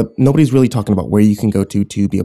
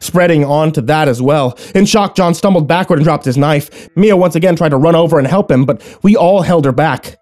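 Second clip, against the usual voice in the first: higher, mounting dramatic urgency; intense story narration; 7 semitones higher, 4 dB louder than usual. A narrator's reading. Narrator watching Philip consumed, intense and urgent narration. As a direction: drive the horror toward its climax.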